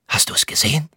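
secretive whispered question; expectant whisper. A narrator's reading. Thilo asks his friend what he saw, whispering expectantly. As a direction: whisper it, pressing for an answer.

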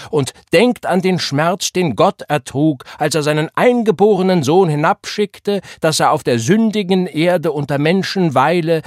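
rising revival preacher, calling out to the church; preaching. Acted. Gabriel preaching to the congregation, fervent revival sermon. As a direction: preach it at full force to a packed congregation.

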